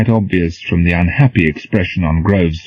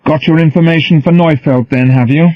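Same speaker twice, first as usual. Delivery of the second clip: mocking sneer, pitched up; sneering quote; 9 semitones higher, 5 dB louder than usual. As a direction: sneer it back at him. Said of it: "He tells me".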